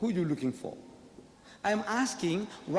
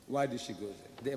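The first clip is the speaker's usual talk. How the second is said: soft low repeat; 6 semitones lower, 7 dB quieter than usual. Real, not acted. Repeats the question, dropped low.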